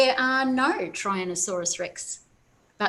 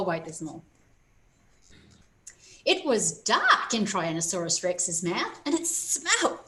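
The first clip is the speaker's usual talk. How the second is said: ordinary delivery, nothing marked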